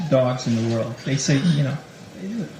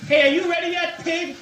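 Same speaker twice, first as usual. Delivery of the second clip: much higher, a mock contemptuous bark at his date; rude impatient voice; 11 semitones higher than usual. Boyfriend to his date after months, rudely impatient. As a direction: sneer it out like a fed-up boyfriend. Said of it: "your tone of voice changes".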